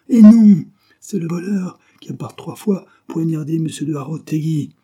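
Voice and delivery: high shouted retort, then insistent; exasperated correction. A narrator's reading. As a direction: burst out at his stupidity. Majordomo exasperated by misunderstanding.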